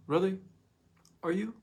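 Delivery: high, pointed sarcasm; sarcastic jab at the excuse; sarcastic challenge. Real, not acted. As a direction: needle them with disbelief.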